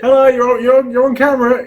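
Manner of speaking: high, loud singsong; playful high-pitched camera warning; mock sing-song warning. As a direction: tease someone that the camera's rolling. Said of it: "you know, like this".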